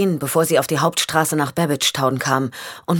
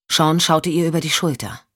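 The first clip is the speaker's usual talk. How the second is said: ordinary delivery, nothing marked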